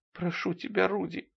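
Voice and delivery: high, soft begging; hushed, tearful plea; pleading. A narrator's reading. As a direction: beg in a small, acted voice.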